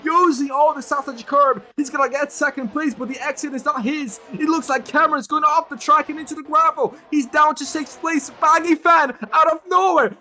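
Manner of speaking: rising, breathless race call; excited commentary. Real, not acted. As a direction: call the chaos at full pitch. Live race crash commentary.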